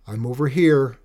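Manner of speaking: loud, reluctant answering call; mournful call. A narrator's reading. Benny answers his mother's call, mildly mournful. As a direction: call back with a touch of mourning. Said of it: "Benny called out, his voice laced with a low, mournful note".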